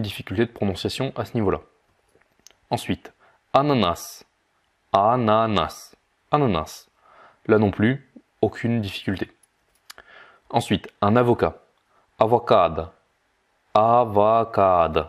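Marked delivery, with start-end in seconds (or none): 4.9-6.9 "Ananas" drawn out syllable by syllable; slowed-down model. Shown as a demonstration. Teacher breaks down the Russian for pineapple, very slow. 13.7-15.0 "Avocado" drawn out as "a-va-kaad"; slowed-down model. Shown as a demonstration. Teacher breaks down the Russian for avocado, very slow.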